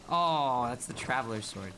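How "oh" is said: normal talking voice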